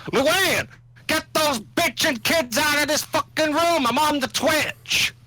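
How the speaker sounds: yelling off-mic at the family; calling across the room, away from mic; turning away from phone. Real, not acted. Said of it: "Sorry, that was my wife".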